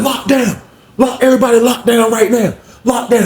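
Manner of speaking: high barked order; retold command shout. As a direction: order everybody in. Sergeant ordering lockdown.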